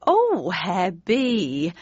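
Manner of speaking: fluttery, flattered sigh; flustered mother. A narrator's reading. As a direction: coo it, flattered. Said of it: "said my flustered mother".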